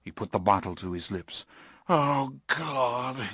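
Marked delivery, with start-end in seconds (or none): 1.9-3.3 a mild moan; understated anguished moan. Acted. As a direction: groan it in despair. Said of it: "he moaned".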